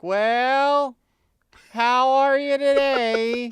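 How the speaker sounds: slow, high, loud folksy drawl; folksy up-north greeting. Retold or imitated. [name] greeting an old lady through the car window, high slow drawl. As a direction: call out to the old lady like a country neighbour. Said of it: "and I'm like".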